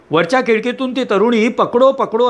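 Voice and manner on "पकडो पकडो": shouting for help. Acted. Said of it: "असं ओरडत असते".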